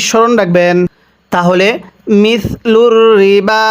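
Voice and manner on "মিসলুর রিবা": slow chanted মিসলুর রিবা with a held ল; tajweed recitation; Quran recitation. Shown as a demonstration. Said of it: "কিভাবে পড়ব?".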